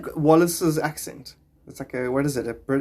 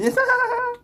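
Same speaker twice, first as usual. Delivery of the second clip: high laugh. Real, not acted. Laughing at own teacher impression.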